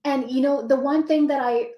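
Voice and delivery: ordinary delivery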